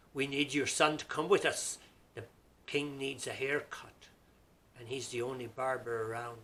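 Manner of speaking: loud, firm order to the widow; stern demand. Acted. Guards telling the widow, firm loud demand. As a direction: announce it like a royal order.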